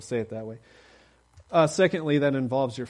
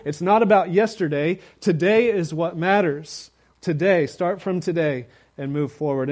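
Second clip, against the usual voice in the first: loud, high fervent preaching; full-force preaching; 7 semitones higher, 8 dB louder than usual. Acted. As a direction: preach it out with rising fire to the congregation.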